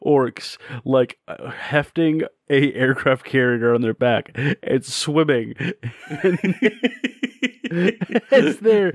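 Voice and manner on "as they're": laughing. Real, not acted. Picturing orcs flying, cracking up.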